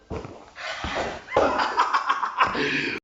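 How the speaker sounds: high breathless laugh of joy; elated laugh. Real, not acted. Lifter laughing in delight after his record lift. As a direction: laugh out the relief of a win.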